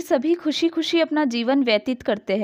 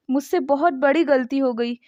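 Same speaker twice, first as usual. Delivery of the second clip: ordinary delivery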